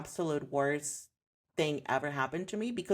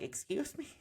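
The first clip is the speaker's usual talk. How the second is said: high, soft, taken-aback question; retold incredulity; 8 semitones higher, 6 dB quieter than usual. Client to coach asking if she's her parents, incredulous. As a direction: act out being taken aback, pitch leaping up.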